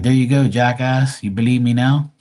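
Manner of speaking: loud, gloating gotcha; triumphant gotcha. Pretend. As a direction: rub it in his face.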